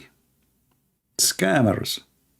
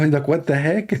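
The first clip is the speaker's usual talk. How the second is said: higher, amused and incredulous; laughing disbelief; 9 semitones higher than usual. Real, not acted. Reacting to the caller's put-on voice, laughing in disbelief. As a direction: laugh breaking into the words. Laughs at retold prank call.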